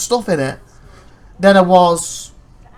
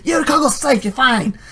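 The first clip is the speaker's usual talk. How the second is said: high-pitched mock reassurance; teasing game voice; 9 semitones higher than usual. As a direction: tease with sing-song high reassurance. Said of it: "the game was teasing you".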